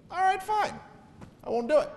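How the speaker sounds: a squeaky, grudging yes; high reluctant God. Acted. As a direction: give in with a high put-upon voice. God voiced conceding.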